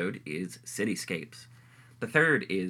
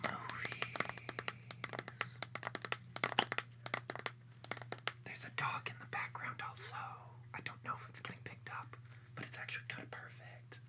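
ASMR style hush; whispering; 14 dB quieter than usual. Pretend. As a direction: whisper softly into the mic. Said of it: "Keep whispering. Whisper something".

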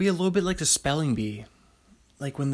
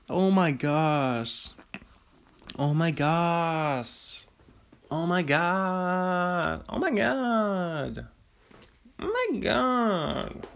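slow, climbing higher with each repeat; excited; 6 semitones higher, much slower than usual. Real, not acted. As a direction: build the thrill higher each time.

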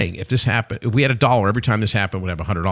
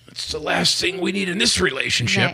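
higher-pitched, self-important husband; puffed-up boasting; 6 semitones higher, 4 dB quieter than usual. Retold or imitated. Husband at a party brushing off the product, puffed-up boasting. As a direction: puff up the chest and boast. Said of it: "he puffs his chest out".

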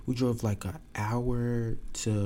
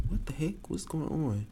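higher, soft, bewildered; just woke to flashing lights; confused alarm; 6 semitones higher, 5 dB quieter than usual. Retold or imitated. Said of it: "I'm like".

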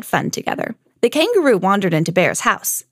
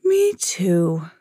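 sleepy mock yawn; drowsy storybook bear; 3 semitones lower than usual. A narrator's reading. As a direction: stretch it out as if yawning. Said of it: "Bear yawned back".

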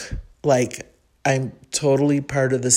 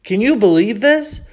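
higher and louder, a stranger's amazed aside; amazed look; 7 semitones higher, 4 dB louder than usual. Retold or imitated. Smiling stranger nodding at the narrator, amazed. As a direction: share the wonder with a stranger.

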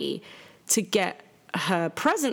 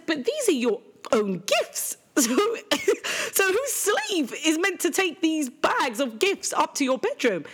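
high-pitched, incredulous retelling with a laugh breaking in; indignant comeback; 10 semitones higher than usual. Retold or imitated. Mum retells scolding her ungrateful daughter, high indignant protest. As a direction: protest in a much higher voice, half laughing.

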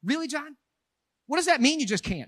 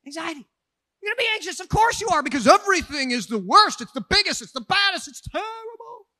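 higher and louder preaching; preaching at full force; 7 semitones higher, 8 dB louder than usual. Acted. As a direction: drive the point home loudly. Sermon climax.

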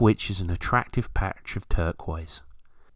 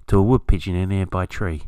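ordinary talk

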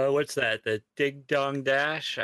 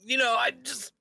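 very high, put-on begging voice; pleading beggar; 14 semitones higher than usual. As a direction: imitate someone meekly asking for a handout. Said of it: "that's the behavior you expect".

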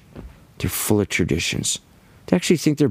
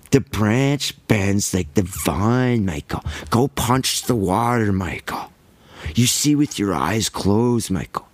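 mock guru wisdom; slower than usual. Mocking a guru's sayings to Michael, slow and hushed. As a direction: parody a dreamy guru, slowly. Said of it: "everyone says".

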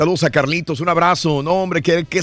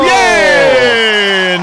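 excited shout; 3 semitones higher, 5 dB louder than usual. Real, not acted. Host celebrating the right answer.

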